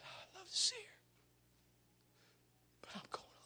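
very soft, fading to a breath; a weak father barely getting the words out; faint, dying voice. Retold or imitated. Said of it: "as good as he could".

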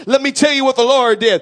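ordinary delivery

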